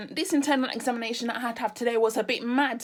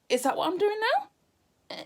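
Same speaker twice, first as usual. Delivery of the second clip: disbelieving; 8 semitones higher, slower than usual. Real, not acted. Disbelief at herself.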